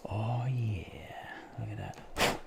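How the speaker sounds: pleased whisper; whispered delight. Real, not acted. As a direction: breathe it out, pleased. Part comes out successfully.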